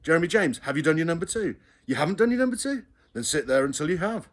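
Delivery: mock mother's scolding question; imitating mother. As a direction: mimic the nagging. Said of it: "Then it was".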